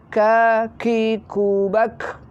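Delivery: slow "kaki kubak" with airy final K; hissed kaf. Shown as a demonstration. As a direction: release a hiss of air on "kubak". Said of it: "Harus ada desisnya". ("kaki kubak" pelan dengan desis)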